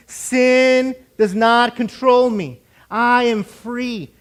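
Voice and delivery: slow, booming rallying chant; loud declaration. Shown as a demonstration. Preacher getting everyone to say it with him, loud and slow. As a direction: proclaim it loudly, word by measured word. Congregation told to repeat after him.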